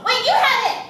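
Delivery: excited. Acted. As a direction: pounce on the find with glee. Spots the backpack she wants.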